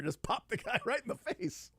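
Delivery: usual speaking voice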